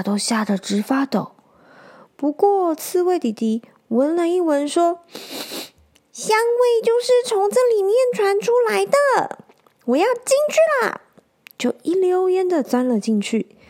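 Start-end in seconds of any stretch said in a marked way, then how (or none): 6.1-11.0 put-on high childlike hedgehog voice, bold and eager; a brave little boy hedgehog charging in; squeaky-high hedgehog kid, excited. Hedgehog character speaks.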